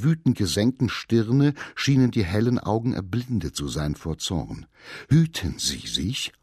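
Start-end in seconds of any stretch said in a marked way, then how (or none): 5.1-6.3 low, slow, breathless warning; panting threat. A narrator's reading. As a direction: hold back fury, panting it out. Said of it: "keuchte er".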